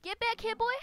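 loud, high holler; high-pitched playful yell; yelling after someone. Pretend. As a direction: shout it at a runaway animal. Yelling at a fleeing animal in game.

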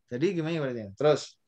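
usual speaking voice

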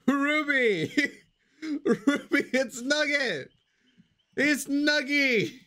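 high, squeaky put-on voice with giggles; cutesy pet voice. Streamer voicing her pet to chat in a high silly accent. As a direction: play a goofy little creature talking to a friend. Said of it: "what accent that is".

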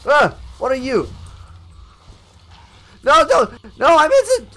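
high, loud, flustered outburst; panicked yelling. Real, not acted. Player surprised by an enemy and fumbling for sword, panicked yell.

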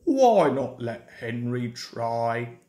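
low, sulky engine jibe; rude. A narrator's reading. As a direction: pitch it right down, scornful. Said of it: "said Gordon rudely".

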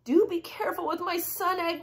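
high, anxious elf plea; acted elfin worry; crying out. A narrator's reading. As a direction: beg in a small high voice. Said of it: "cried the elf".

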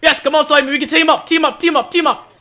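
high, loud, urgent rallying; excited calling out. Real, not acted. Player urging Slimy to join the fight, excited calling out.

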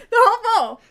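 loud, shocked disbelief. Real, not acted. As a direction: cry out in shock. Shocked at eating it all.

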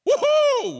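high, loud Woohoo whoop; victory whoop. Acted. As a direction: whoop like you just won the match. Acting out a victor's cheer.

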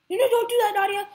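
high, loud panicked plea; panicked yelling. Real, not acted. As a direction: yell at her to stop, frantic. Begs teammate to stop.

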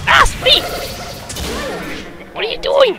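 very high alarmed calling; high panicked call. Acted. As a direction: shriek at your teammate.

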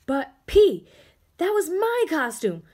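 upset interruption; indignant protest. Acted. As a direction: object loudly to being skipped.

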